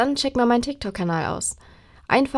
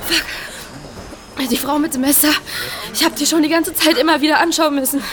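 shaken and agitated; 6 semitones higher than usual. Acted. Nach der Flucht aufgewühlt.

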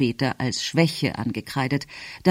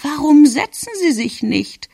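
put-on high, raised old-lady voice; insistent grandmother; 10 semitones higher, 4 dB louder than usual. A narrator's reading. Grandmother interrupts the delegation to offer seats, insistent and high. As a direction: pipe up high over the speaker.

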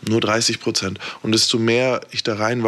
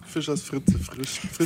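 Zungenbrecher-Übung; 5 semitones higher, 6 dB quieter than usual. Shown as a demonstration. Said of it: "mach eine Sprachübung".

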